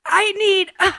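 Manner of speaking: making fun of a buddy's blooper, high-pitched; imitating the actor. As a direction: mimic the actor's grunted read. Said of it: "He did, like".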